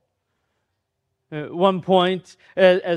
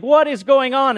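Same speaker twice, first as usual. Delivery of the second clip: higher, louder panicked question; panicked cry; 7 semitones higher, 5 dB louder than usual. Retold or imitated. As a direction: panic as the storm hits. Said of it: "he's like".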